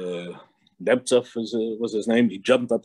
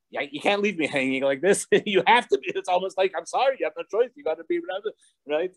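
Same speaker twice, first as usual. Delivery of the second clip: high-pitched, half-laughing mock plea; retold pleading; 7 semitones higher than usual. Follower begs the Rebbe to take leadership, high mock pleading. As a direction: plead as if left hanging, lightly acted.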